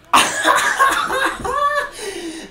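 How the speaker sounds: breathless high-pitched laugh of disbelief; giddy high laugh. Real, not acted. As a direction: laugh it out high, can't believe it. Disbelieving after the goal.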